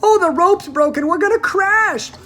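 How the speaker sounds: high, loud panicked cry; cried out. A narrator's reading. Missus T V in the falling lift, crying out in panic. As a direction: shriek it as if about to crash. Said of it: "Missus T V cried out".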